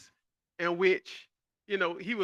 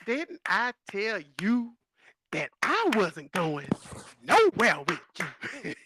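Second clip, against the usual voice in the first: slow, word-by-word scolding with a clap on each beat; mad clapping woman; 2 semitones higher, 4 dB louder, slower than usual. Retold or imitated. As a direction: spell out every syllable like a furious woman. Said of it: "mad, have to clap after every syllable".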